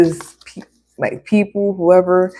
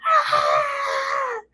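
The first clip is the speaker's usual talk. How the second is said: cartoon yell; 6 dB quieter than usual. [name] getting triggered.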